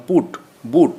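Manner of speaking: পুট and বুট with short u; short u sound. Shown as a demonstration. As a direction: keep the vowel brief in পুট, বুট. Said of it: "উচ্চারণ হবে হর্ষ".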